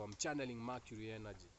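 usual speaking voice

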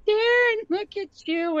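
put-on a nurse moved to see the child; slow high tender cry; tender amazement. Crying nurse greets the boy.

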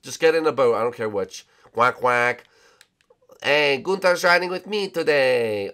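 normal talking voice

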